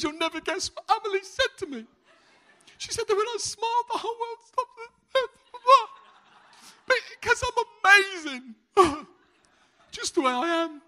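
mock tearful joy, high and loud; tearful grandma. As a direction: squeak it out like a weepy, delighted gran. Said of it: "my mum's proper bawling".

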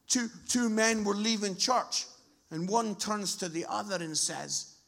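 ordinary delivery

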